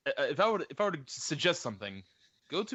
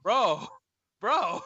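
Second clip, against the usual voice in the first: higher, louder call of Bro; shocked protest; 7 semitones higher, 5 dB louder than usual. Real, not acted. Friend reacting to a crude joke, loud shocked call. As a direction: cry out in disbelief.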